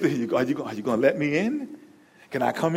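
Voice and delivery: soft, low plea at the door; hushed, patient Jesus asking to come in; gentle knock. Acted.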